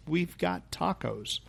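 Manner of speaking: slow, soft tagline; tagline delivery. Acted. Guest unveiling a San Antonio tagline, slow and soft. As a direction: deliver it slowly and quietly, like a slogan.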